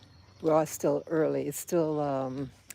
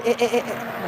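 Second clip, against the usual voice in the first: short higher bleats like a goat; goat bleating; 5 semitones higher, 7 dB louder than usual. Retold or imitated. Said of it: "like that, you know?".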